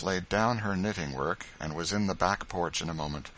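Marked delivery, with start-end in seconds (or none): none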